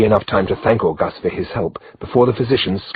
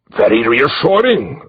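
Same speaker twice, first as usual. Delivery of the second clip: much higher and louder, slow and nervous; uneasy; 7 semitones higher, 5 dB louder, slower than usual. A narrator's reading. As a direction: Odo forcing out a nervous remark, high and loud. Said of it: "Odo swallowed uneasily".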